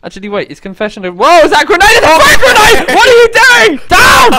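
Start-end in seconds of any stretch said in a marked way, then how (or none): 1.1-4.3 high frantic shouts of alarm; panicked yelling. Real, not acted. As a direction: yell a warning in panic. Grenade thrown, panicked yelling.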